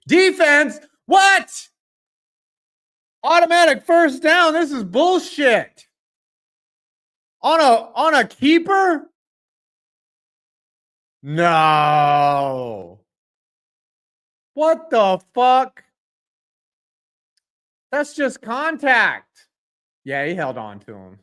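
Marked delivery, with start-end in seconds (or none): 0.1-4.5 high, loud yell of disbelief; outraged shout. Real, not acted. Outraged at a penalty call. 4.5-19.5 slow, loud disbelieving protest; angry complaint. Real, not acted. Complaining about the penalty.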